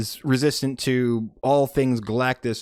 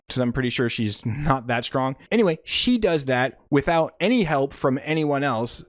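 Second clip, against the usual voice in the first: ordinary talk, nothing marked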